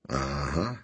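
mumbled with a full mouth. Acted. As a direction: talk through a mouthful. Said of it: "with his mouth full".

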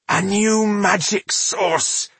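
slow, halting words in pain; stuttering. Acted. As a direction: stammer it out through the pain. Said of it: "she stuttered".

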